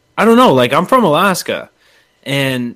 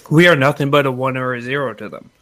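usual speaking voice

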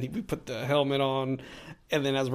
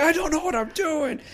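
much higher, frantic admission of being lost; panicked admission. Retold or imitated. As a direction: wail it in helpless panic. Panicked in the VR game.

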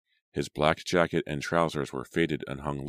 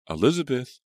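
louder, higher alarmed shout; 9 semitones higher, 6 dB louder than usual. A narrator's reading. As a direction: call out in real alarm. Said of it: "alarmed voices".

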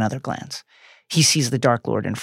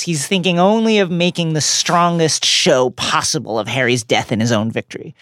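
normal talking voice

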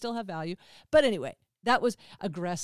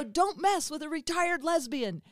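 higher, swaggering mock threat; mock tough; 7 semitones higher than usual. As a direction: play tough for laughs. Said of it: "and I go".

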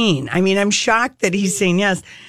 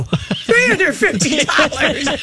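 slow, higher, shocked echo of the price; loud shocked repeat; 5 semitones higher, slower than usual. Retold or imitated. Hearing the stylist's price, she repeats it in shock. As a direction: blurt it out in disbelief. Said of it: "I say it really loud".

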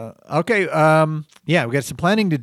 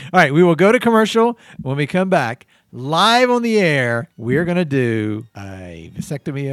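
announcer voice; 6 dB louder than usual. Acted. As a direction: stretch it out like a host. Announcing going to commercial.

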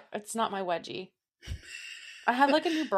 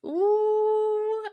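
a high, drawn-out ooh of interest; intrigued ooh; 14 semitones higher than usual. Real, not acted. Cohost hearing the case name, high intrigued ooh. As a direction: perk up at the juicy topic.

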